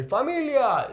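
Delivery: high exclaimed imitation of songs; song-style call. Teacher imitating how songs shout it to everyone, high exclamation. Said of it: "you hear this in songs".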